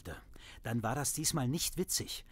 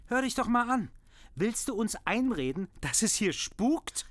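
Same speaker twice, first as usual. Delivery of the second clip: high, loud exasperated scolding; furious father shouting down the ghost story; angry; 9 semitones higher, 6 dB louder than usual. Acted. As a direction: snap at her in disbelief. Father argues with daughter.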